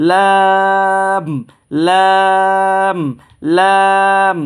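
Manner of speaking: লাম held for three alif lengths; drawn-out Lam. Shown as a demonstration. Teacher demonstrating Lam to students, long and drawn. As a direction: stretch লাম slowly and evenly. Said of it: "তিন আলিফ টানবো".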